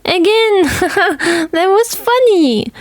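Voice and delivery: very high, laughing squeal; girl begging to fall into the snow again; delighted girl. A narrator's reading.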